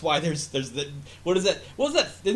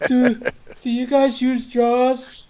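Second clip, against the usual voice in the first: much higher, louder, slow blurting; loud high slow blurted question at the panel; eager loud question; 10 semitones higher, 11 dB louder, much slower than usual. Retold or imitated. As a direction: call out too eagerly to the panel. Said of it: "that's him trying to assert himself".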